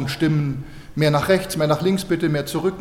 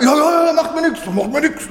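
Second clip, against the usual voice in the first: much higher, eager mock old hitchhiker; hitchhiker's reply; 9 semitones higher than usual. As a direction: play a cheerful stranger, pitched way up. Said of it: "Und dann hat der Mann gesagt". (viel höher, eifrig gespielter Anhalter)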